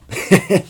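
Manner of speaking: short high unvoiced laugh; a quick disbelieving laugh; burst of laughter. Real, not acted. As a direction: laugh at the grim twist. Laugh at the shocking twist.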